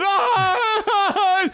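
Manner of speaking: long, high bawling scream; hammed-up wail, as if being killed; mock crying wail. Student breaking down before the whole auditorium, imitated bawling. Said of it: "just crying".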